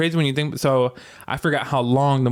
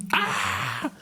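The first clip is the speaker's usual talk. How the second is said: hands on face scream; high gasping yelp; mock scream; 6 dB quieter than usual. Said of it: "He was doing this all the time".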